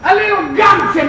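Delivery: high-pitched, driving sermon voice; forceful preaching. Real, not acted. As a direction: preach hard, stretching the key words.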